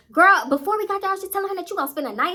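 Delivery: mock defensive protest. Friend defending herself in an argument, mock defensive. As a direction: act out a friend rushing to explain herself.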